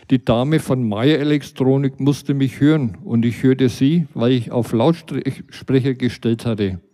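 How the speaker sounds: ordinary delivery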